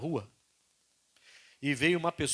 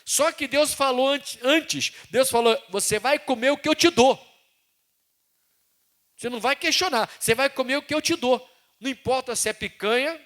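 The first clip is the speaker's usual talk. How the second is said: loud, raised preaching voice; full-force preaching; 8 semitones higher, 8 dB louder than usual. Acted. Preacher to the congregation, loud full-force preaching. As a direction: proclaim it to the church. Preacher proclaiming God's word.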